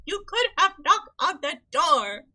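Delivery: hammed-up indignant yell; mock outraged shout. Character scolds late wake-up.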